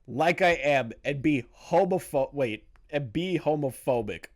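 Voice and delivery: ordinary delivery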